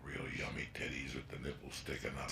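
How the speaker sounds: dropped to a near whisper; hushed. Real, not acted. Telling the crowd a secret in a whisper. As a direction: lean in and whisper it. Confiding a crude memory.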